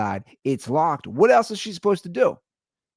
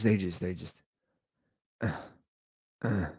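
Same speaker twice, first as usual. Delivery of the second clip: ordinary talk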